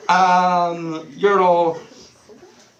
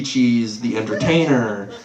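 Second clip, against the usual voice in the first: ordinary talk, nothing marked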